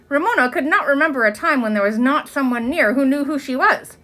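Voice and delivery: usual speaking voice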